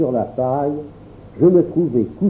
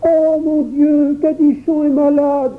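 put-on high, alarmed child crying out; cri d'enfant alarmé; 12 semitones higher than usual. A narrator's reading. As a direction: call out in a panic as a little boy. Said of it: "s'écria le petit Jacques". (cri aigu d'un petit garçon affolé)

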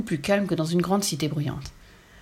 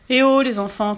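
raised, higher-pitched call; 5 semitones higher, 5 dB louder than usual. A narrator's reading. Gentleman hails the children at the villa, calling out. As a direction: shout over to get their attention. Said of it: "cria-t-il".

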